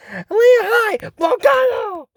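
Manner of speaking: high, loud character cry; excited high-pitched battle call; called out attack. Acted. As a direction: shout it high like a cartoon attack. Retells character calling out attack.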